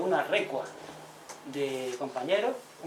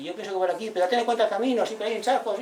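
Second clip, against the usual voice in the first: higher and louder, pitching in eagerly; animated advice; 6 semitones higher, 5 dB louder than usual. Retold or imitated. Villager adding advice on the road, higher and eager. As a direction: chime in eagerly with a tip.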